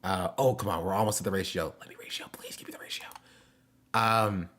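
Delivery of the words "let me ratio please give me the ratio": fast whispered begging; whispered plea. Real, not acted. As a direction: beg under your breath quickly. Begging for the ratio.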